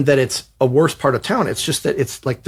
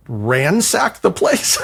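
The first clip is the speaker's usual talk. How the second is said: slow, whiny disbelief; aghast; 2 semitones higher, slower than usual. Retold or imitated. Writer told to search the flat, slow and aghast. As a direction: drag it out like it hurts.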